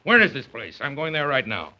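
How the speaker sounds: he won't wait a minute; impatient indignant father; outraged father. Acted.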